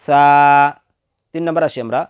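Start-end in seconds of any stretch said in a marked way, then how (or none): 0.1-0.2 সা drawn out as a soft th; soft articulation. Shown as a demonstration. Teacher models the letter for learners, soft drawn-out সা. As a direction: say সা softly from the tongue tip. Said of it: "নরমভাবে আদায় হবে".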